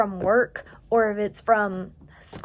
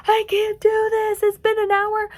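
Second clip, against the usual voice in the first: mock despair; 11 semitones higher, 4 dB louder than usual. Crocheter recalling the challenge, in a high whiny voice. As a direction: whine it out like you're giving up. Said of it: "I was like".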